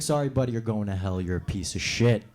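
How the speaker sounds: low, flat dismissal from God; lightly voiced deep judge. God refusing the slave owner, low and dismissive. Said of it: "And God's like".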